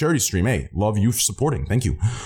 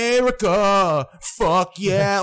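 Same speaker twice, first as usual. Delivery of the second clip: high, drawn-out mock patriotic cry; movie catchphrase impression; 7 semitones higher, slower than usual. Streamer mimics the movie line their accent evokes, mock patriotic cheer. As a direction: imitate a gung-ho American film chant. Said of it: "all I thought about was, uh, uh, that one movie".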